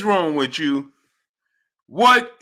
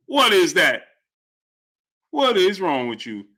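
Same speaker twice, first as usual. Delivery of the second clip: ordinary talk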